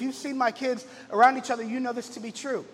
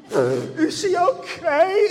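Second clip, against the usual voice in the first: high, loud, slow, wailing worry; tearful big sister; 7 semitones higher, 7 dB louder, much slower than usual. Retold or imitated. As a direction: sob it out as a scared girl. Big sister crying over sibling.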